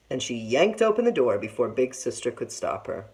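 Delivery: ordinary delivery, nothing marked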